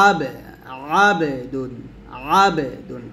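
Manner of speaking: আবেদুন opening on a squeezed throat sound; pressed ayn. Shown as a demonstration. Teacher drilling the word, pressed ayn. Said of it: "আইনটা অবশ্যই চাপ দিয়ে".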